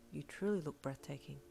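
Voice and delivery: soft, earnest admiration; hushed sincerity; tender compliment. A narrator's reading. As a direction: say it quietly, in awe. Sincere compliment, softly voiced.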